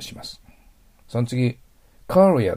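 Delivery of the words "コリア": コリア as courier, high first vowel; English pronunciation. Shown as a demonstration. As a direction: model コリア with an open, lowered-tongue first vowel. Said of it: "と発音します".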